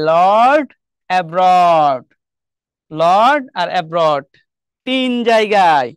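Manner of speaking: slow and deliberate, Lord and abroad spelled out; slow emphatic listing. Shown as a demonstration. Teacher slowly sums up the rhyming pairs for students. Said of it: "এই যে".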